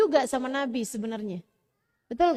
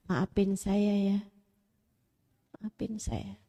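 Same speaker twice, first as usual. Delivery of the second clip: whispered, pleading apology; slower than usual. Acted. Wife apologizing to husband in a whisper. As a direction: breathe it out like a sincere plea. Wife's soft apology voiced.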